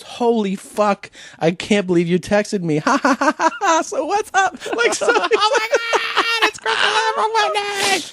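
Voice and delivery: mock starstruck girl, stretching words in a very high pitch; excited fangirl. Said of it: "how excited the girls are".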